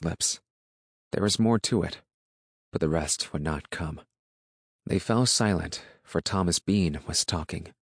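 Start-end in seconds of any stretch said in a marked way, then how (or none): none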